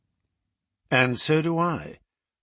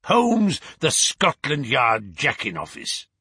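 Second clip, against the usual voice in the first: scornful outburst; 3 dB louder than usual. Acted. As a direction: cry the name, then sneer the title. Visitor's insults reach their peak.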